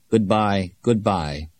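a hint of a heartbroken old ambassador; subdued goodbye, lightly voiced; lightly mumbled farewell. A narrator's reading. Heartbroken ambassador takes his leave after declaring war, lightly mumbled. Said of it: "mumbled the elderly, heartbroken ambassador".

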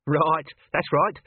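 slow, eager grateful agreement. Acted. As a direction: agree gratefully, almost relieved. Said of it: "said Chicken Wire gratefully".

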